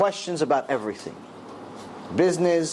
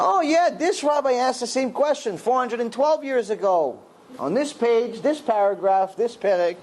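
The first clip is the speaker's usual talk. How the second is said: raised, eager voice imitating a scholar; encouraging enthusiasm; 8 semitones higher than usual. Rabbi citing a centuries-old source from memory, encouraging enthusiasm. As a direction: recite the citation high and delighted. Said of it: "he'd make me feel good about it".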